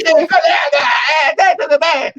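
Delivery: loud, high shouted hello to the group; laughing shout of "E aí, galera"; boisterous greeting. Retold or imitated. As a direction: barge in calling out like a football fan. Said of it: "cheguei lá, flamenguista".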